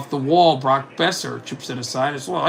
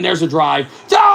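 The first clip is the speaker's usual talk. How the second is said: excited goal shout; 2 semitones higher, 6 dB louder than usual. Real, not acted. Commentator calls the drive going in, excited shout. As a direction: build into a long, held yell.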